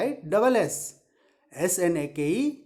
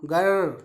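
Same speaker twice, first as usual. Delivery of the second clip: long rolled-r grrr; teacher's demonstration growl; tiger growl; 3 semitones lower than usual. Shown as a demonstration. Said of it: "tiger का sound".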